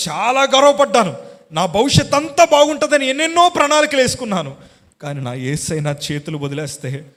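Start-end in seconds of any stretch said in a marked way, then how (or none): 0.1-4.6 much higher and louder, a preacher's soaring cry; preaching at full force. Acted. Preacher testifying to the church, crying out loudly. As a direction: lift the congregation with a loud, ringing confession.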